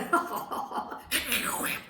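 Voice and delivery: breathy mocking cackle; teasing monkey laugh. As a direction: laugh at her like a cheeky monkey.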